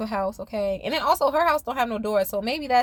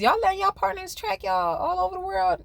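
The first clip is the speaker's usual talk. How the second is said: amused question; 9 semitones higher than usual. Real, not acted.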